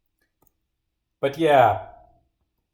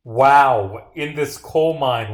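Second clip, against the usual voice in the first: mock wonder; 7 dB louder, much slower than usual. Mock awe at coal mine.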